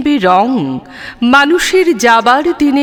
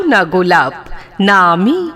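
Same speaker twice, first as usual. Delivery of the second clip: normal talking voice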